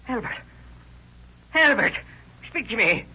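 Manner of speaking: crying out over a brother's body, begging him to answer; anguished cry. Acted. Old man finds his brother shot.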